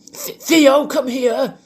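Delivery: high, loud call across a park, "Theo" said as "Feo"; calling a dog in a park. Retold or imitated. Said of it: "I look like a fucking gimp".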